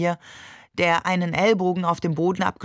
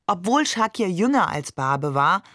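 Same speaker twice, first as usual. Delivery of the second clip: ordinary delivery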